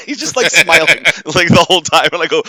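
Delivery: loud words broken by laughter; can barely get it out for laughing. Real, not acted. Laughing while telling the story.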